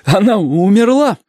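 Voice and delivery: high, slow, drawn-out question; worried sailor's put-on high voice; alarmed sailor. A narrator's reading. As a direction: ask in dismay, pitch climbing well up. Sailor asks if woman died.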